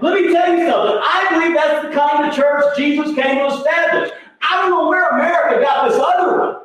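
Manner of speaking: high, sustained pulpit cry; full-force preaching. Real, not acted. Preacher tells congregation what church is for, full-force preaching. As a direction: drive each phrase home at the top of the voice.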